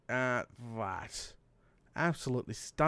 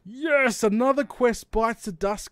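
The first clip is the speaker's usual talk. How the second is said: triumphant; 6 semitones higher, 6 dB louder than usual. Real, not acted. Quest completed.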